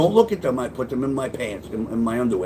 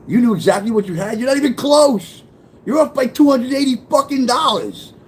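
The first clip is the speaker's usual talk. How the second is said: high, loud mocking voice; mocking disbelief; 9 semitones higher, 5 dB louder than usual. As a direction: sneer it out loud, laughing at her. Mocking her in his head.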